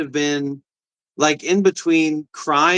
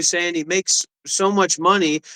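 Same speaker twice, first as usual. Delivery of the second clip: ordinary delivery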